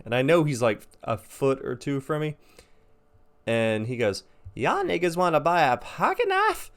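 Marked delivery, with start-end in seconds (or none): 4.6-6.7 high put-on hawker's voice; mocking homeless man. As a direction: imitate his squawked sales pitch. Man's sales pitch in dark, retold.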